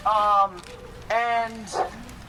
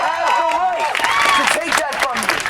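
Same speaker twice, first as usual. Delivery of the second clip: rising impassioned shout; 5 semitones higher, 6 dB louder than usual. Real, not acted. Impassioned rally speech builds up.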